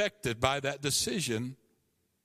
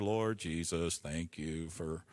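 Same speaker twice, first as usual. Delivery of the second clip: mock pious prayer; 11 semitones lower, 6 dB quieter than usual. Mock dutiful prayer at altar.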